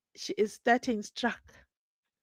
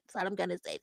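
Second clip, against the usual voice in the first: hushed repeat; 10 dB quieter, much faster than usual. Real, not acted.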